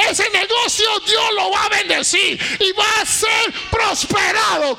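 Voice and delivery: high, loud, declaring prophetic preaching; fiery church exhortation; full-force preaching. Real, not acted. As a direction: proclaim it over the congregation at full voice.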